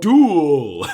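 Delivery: loud, high, drawn-out battle call; playful announcer shout. Pretend. Co-hosts hyping the duel on screen, playful shout. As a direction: belt it out like a mock duel announcement.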